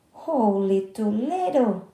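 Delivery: loud, slow shocked outburst; shocked exclaim. Acted. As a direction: gasp it out in disbelief. Ben shocked at the bank.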